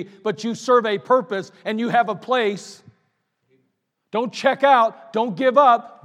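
loud rising sermon exhortation; preaching at full force. Acted. Pastor urging older members not to quit, preaching loudly.

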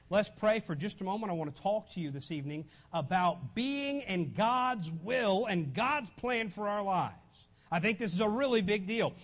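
ordinary talk

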